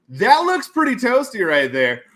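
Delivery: high, loud, slow goofy voice; big drawn-out approval; hammed-up repeat. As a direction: stretch it out with silly relish.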